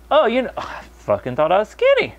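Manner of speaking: high, indignant mock voice of a clueless fat person. Mocking a fat person who thinks he's skinny, pitched high. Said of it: "that's just like".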